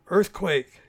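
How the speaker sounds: loud alarmed shout of the word; Earthquake! shouted in alarm. A narrator's reading. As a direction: yell out a warning as the ground shakes. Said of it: "shouted Christopher".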